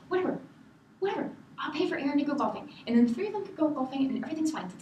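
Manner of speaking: high, fast, brushing it all aside; imitated peacemaking sister-in-law. Sister-in-law tries to piece everything over, imitated as high and rushed. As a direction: hurry to smooth it over in a high voice. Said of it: "tries to piece everything over".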